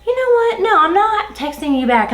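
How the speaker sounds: high-pitched, defiant retort; fired up. Retold or imitated. Employee refusing to reply to boss, fired up. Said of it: "I'm just so fired up".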